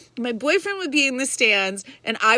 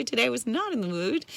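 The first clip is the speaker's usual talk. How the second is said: usual speaking voice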